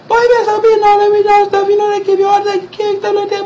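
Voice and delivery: very high falsetto nagging; scolding mama. Retold or imitated. Mama lecturing her son at hospital, high scolding. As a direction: mimic a mother telling off a son in falsetto.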